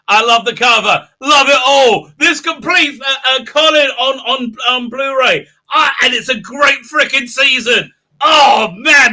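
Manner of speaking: loud, high-pitched gushing; uncontained excitement. Real, not acted. Fan raving about the new Blu-ray release, overexcited. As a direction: gush loudly, unable to hold it in. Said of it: "I tried to control myself. No, I didn't".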